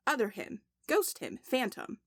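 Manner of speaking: ordinary talk, nothing marked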